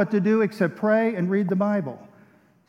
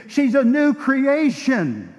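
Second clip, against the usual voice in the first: proclaiming; 6 semitones higher, 5 dB louder, much slower than usual. Acted. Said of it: "preaching climax".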